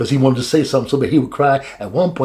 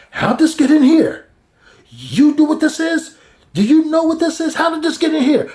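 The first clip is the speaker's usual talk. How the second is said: high-pitched mock accusing voice; accusing official; 3 dB louder than usual. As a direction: act shocked and accusing in a raised voice. Guard confronts brothers over chalice.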